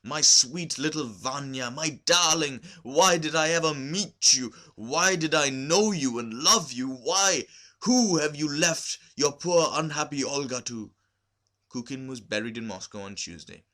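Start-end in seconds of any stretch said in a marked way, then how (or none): 0.0-10.9 higher, louder, slow grieving lament; sobbing lament. A narrator's reading. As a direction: pour out her grief for her dead husband. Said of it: "Olga sobbed".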